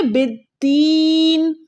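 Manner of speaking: बिद्दीन with the "dee" held very long; drawn-out recited बिद्दीन; stretched daal. Shown as a demonstration. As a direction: slowly chant बिद्दीन, stretching the ee before the n. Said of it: "हम दाल को खींच रहे हैं".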